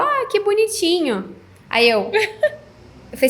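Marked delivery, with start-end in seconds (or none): 0.0-1.3 high, slow mock coo; husband cooing. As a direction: imitate the husband cooing at the toy. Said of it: "olhou aquela naninha e falou". (arremedando o marido, agudo e lento)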